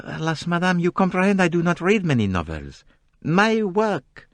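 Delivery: put-on polite Belgian-French detective accent; courteous French-accented reply; gentle French accent, very polite. A narrator's reading. Poirot demurs.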